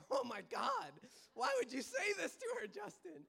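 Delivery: high, loud, quick scolding; hammed-up indignant protest; mock outrage. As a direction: scold in shrieky disbelief, imitating an offended sister. Acted outraged French sister.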